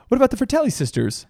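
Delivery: imitated movie jock. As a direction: pitch it way up as the movie character. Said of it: "He's like".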